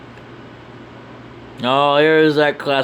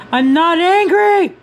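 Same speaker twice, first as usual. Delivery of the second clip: loud, high, drawn-out mock outburst; angry denial; 7 dB louder, slower than usual. Character accused of looking angry, high angry outburst. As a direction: protest loudly, pitched way up.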